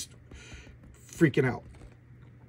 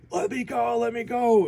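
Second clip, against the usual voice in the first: high, hammed-up panicked cry; mock freaking out; 9 semitones higher, 4 dB louder than usual. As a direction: act out a frantic demand for release. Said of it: "he's just freaking out".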